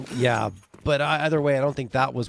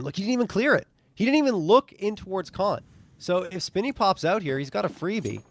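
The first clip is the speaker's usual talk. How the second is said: higher, urgent analyst call; esports caster; 8 semitones higher than usual. Acted. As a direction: point out the open angle eagerly. Caster builds anticipation.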